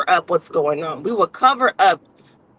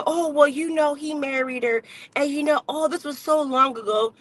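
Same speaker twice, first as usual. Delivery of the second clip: mocking excuse-makers; 6 semitones higher, 4 dB quieter than usual. Mocking people making excuses.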